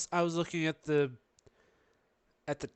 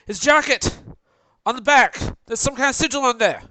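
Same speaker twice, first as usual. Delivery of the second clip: shouting; 9 semitones higher, 10 dB louder than usual. Acted. Shouting warning to party in-game.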